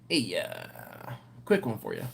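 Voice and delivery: a mocking little take on the old boss; coworkers imitating Ed. Coworkers still joking about manager Ed, lightly mocking. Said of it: "my coworkers and I".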